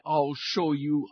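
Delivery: slow, drawn-out threat. A narrator's reading. Wind vowing to beat the sun, slow threat. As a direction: stretch each word like a building storm. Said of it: "roared the wind".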